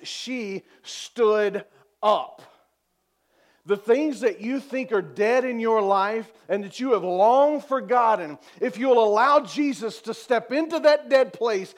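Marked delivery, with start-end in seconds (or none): 3.6-11.7 loud, building preacher's exhortation; full-force preaching. Real, not acted. Preacher urging congregation to let Jesus in, loud and building.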